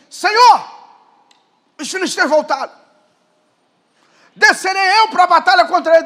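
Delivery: loud, high cry up to the Lord; David calling on God. Acted. David asks God before battle, loud appeal. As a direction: call out urgently as David. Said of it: "aí Davi falou assim".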